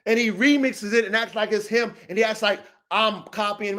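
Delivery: ordinary talk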